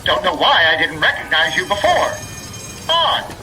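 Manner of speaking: high, loud showman's call; loud hailing announcer. Acted. Raffle announcer hails Assemblyman Buford, loud and showy. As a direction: hail an important guest at full volume.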